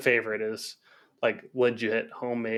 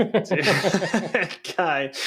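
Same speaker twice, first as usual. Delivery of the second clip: laughing; 6 semitones higher, 4 dB louder, much slower than usual. Real, not acted. Laughing at Jamie Oliver's cooking.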